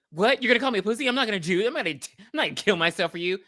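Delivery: high-pitched, mock-offended protest; indignant refusal. Rider refuses the dare in retelling.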